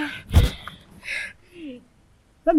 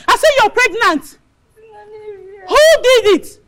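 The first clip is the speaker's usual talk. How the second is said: louder and higher, demanding; raised accusation; 4 semitones higher, 5 dB louder than usual. Acted. Madam demanding who got the girl pregnant, raised voice. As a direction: demand an answer.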